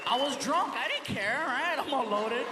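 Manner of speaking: ordinary talk, nothing marked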